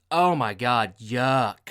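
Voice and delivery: loud, hammed-up revulsion; disgusted cry. Acts out woman disgusted at bathroom.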